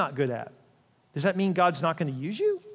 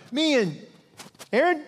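proud announcement; 9 semitones higher, 3 dB louder than usual. Acted. Moses proudly naming Aaron.

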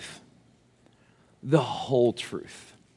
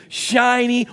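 usual speaking voice

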